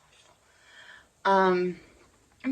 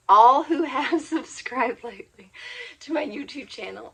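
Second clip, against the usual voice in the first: laughing; 5 semitones higher, 8 dB louder than usual. Real, not acted. Flustered thanks to subscribers.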